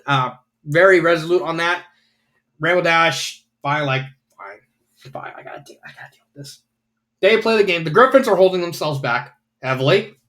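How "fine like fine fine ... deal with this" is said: grumbled under her breath, almost whispered; grudging whisper. Retold or imitated. Rainbow Dash to herself, giving in, grumbling whisper. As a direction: mutter it like you're giving in.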